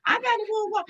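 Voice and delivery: high, laughing self-scolding, half crying; laughing at herself. Retold or imitated. Talking herself out of a week of resentment, laughing. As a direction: scold yourself through laughter. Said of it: "and I'm like".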